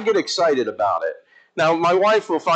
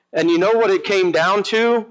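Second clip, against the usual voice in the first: ordinary delivery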